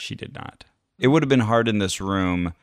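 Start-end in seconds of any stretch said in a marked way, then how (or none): 0.0-0.6 playful near-whisper; hushed echo. Pretend. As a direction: echo it softly under your breath. Echoes the hushed punchline.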